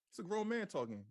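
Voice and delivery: ordinary delivery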